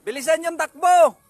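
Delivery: loud call across the yard. Real, not acted. Calling kids for next round.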